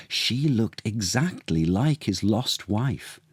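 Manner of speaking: plain reading voice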